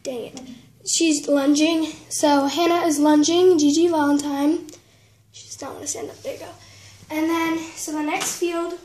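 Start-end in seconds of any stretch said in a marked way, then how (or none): 5.3-6.5 softer whispered comment to herself; whispered aside. Real, not acted. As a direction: whisper it under your breath while fiddling. Aside while toy won't stand.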